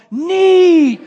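high, loud, drawn-out "Neat"; childlike wonder. Retold or imitated. Echoing coworker's childlike word.

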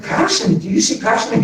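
mock outrage at being called passionate; high indignant repeat; high incredulous echo. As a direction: echo the word back, pitched high. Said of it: "And I'm like".

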